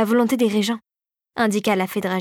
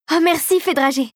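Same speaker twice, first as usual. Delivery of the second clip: higher, joyful burst of thanks; exclaiming; 6 semitones higher than usual. A narrator's reading. Girl hugs the fairy, joyful exclamation. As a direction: throw the thanks out delightedly. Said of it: "s'exclama Clara".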